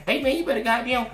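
put-on street-talk voice; slang impression. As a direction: imitate someone laying the slang on thick. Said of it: "he speak a little slang".

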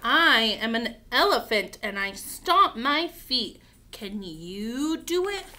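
slow, loud picture-book read-aloud; reading to children. Acted. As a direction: stretch each word out boldly for little ones.

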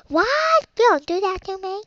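high, squealed mock outrage; shocked protest. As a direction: play a character squeaking in dismay. Acted protest in Buttercup skit.

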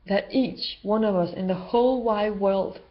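ordinary talk

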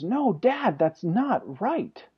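imitated child protest, high then dragged out slowly; emotional son. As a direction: play a boy getting upset, stretching each word. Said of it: "he's starting to get emotional about it".

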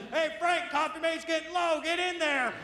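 high, put-on boss calling a worker; acted supervisor calling across the warehouse. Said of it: "they just send some guy".

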